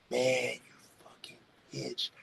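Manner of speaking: hissed, slow whispered insult; whispering a threat at Leo. Retold or imitated. Threat voiced.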